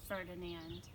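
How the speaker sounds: usual speaking voice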